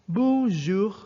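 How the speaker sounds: "bonjour" pronounced in French, model for the class. Shown as a demonstration. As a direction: say "bonjour" the French way, nasal bon, throat R. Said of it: "Repeat after me".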